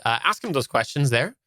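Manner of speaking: ordinary delivery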